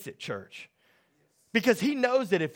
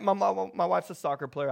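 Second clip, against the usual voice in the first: ordinary talk, nothing marked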